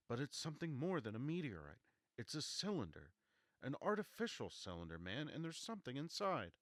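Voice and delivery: high, loud, insistent; urgent. Acted. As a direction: press the point urgently.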